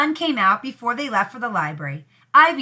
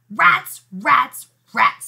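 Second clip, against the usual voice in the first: louder, exasperated rats, fading down; frustrated rats; 2 semitones higher, 6 dB louder, much slower than usual. A narrator's reading. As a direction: stamp out each rats in frustration. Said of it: "Ivy decided to sleep".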